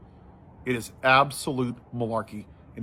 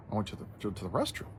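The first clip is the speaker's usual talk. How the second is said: much softer, hesitant answer; sheepish reply; 4 semitones lower, 10 dB quieter, faster than usual. Retold or imitated. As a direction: mumble it like it's obvious but awkward. Answering wife's puzzled question sheepishly.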